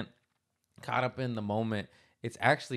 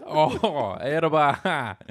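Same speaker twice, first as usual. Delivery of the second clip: higher, louder mangled Irish attempt; hammed-up failed Irish lilt; bad Irish accent; 4 semitones higher, 5 dB louder, much slower than usual. Shown as a demonstration. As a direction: botch an Irish accent on nonsense sounds. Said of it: "tell me to do an Irish accent".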